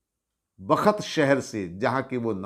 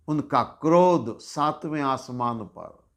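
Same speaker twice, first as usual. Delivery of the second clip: ordinary delivery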